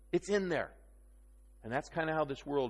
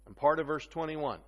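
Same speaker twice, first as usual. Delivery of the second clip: usual speaking voice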